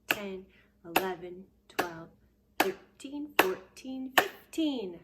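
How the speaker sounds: loud, clapped counting, each number punched. Shown as a demonstration. Teacher counting claps for kids, loud and rhythmic. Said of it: "Let's clap our hands fifteen times".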